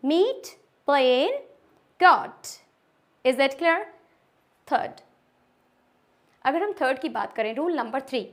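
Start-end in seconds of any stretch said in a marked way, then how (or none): 0.1-2.6 "meet, plain, got" higher, each vowel drawn out; long vowel drill. Shown as a demonstration. Teacher drilling the three examples for the class, long vowels. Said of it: "वापस से मैं एक बार फिर से करवा रही हूँ".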